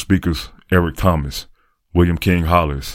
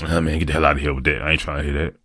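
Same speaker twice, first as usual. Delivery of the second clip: lightly acted grumpy brush-off, tailing off softer; mock dismissive passerby; 3 dB quieter than usual. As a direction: wave off an unwanted greeting, half under your breath. Imagined stranger brushing off his greeting.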